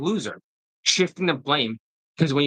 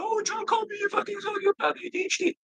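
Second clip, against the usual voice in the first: much higher falsetto whine, mocking; whiny mockery; 5 dB quieter than usual. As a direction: whine it like an offended crybaby. Mocking critics' complaints.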